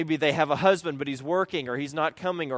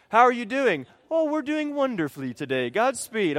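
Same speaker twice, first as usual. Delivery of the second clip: high, sugary put-on brightness; fake cheer; 8 semitones higher, 4 dB louder than usual. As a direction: switch to the church smile voice. Fake cheerful church face.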